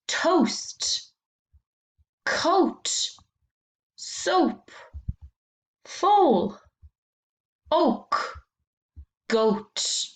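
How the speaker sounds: each word alone, said slowly and clearly, a high falling tone; clear reading of each word. Shown as a demonstration. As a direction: read the list like a teacher, word by word. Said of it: "I'm going to read them".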